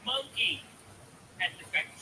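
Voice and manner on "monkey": high mock yell; imitated child's scream. Dad retells his daughter's outburst, high mock scream. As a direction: cry it high, a smaller echo of a shriek. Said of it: "She's screaming".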